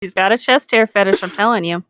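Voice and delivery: ordinary talk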